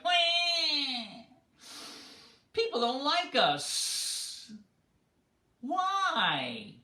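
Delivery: high, slow, whining wail; bewildered snakes crying out; drawn-out high lament of hurt snakes. Acted.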